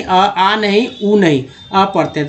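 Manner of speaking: ordinary talk, nothing marked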